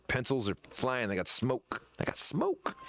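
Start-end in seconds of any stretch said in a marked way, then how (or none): 2.0-2.8 high-pitched playful echo; squeaky high voice. Pretend. Repeats line in silly high voice.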